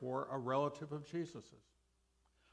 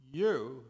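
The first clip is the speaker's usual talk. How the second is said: "you" punched clearly louder; stress on you; 7 dB louder than usual. Shown as a demonstration. Preacher stressing "you" to readers who had not yet believed. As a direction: hit the word "you" hard. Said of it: "Notice I've emphasized the word "you".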